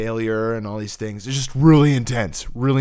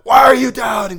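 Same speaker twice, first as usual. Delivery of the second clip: loud high scolding; harsh accusing shout; 12 semitones higher, 10 dB louder than usual. Shown as a demonstration. Contrasting the wrong tone of God, a loud scolding shout. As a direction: bark it as a rebuke.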